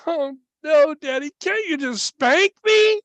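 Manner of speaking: put-on loud, high-pitched child's plea; hammed-up high boyish wail; pleading little boy. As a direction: imitate a distressed son begging Daddy. Voicing son begging for spanking instead.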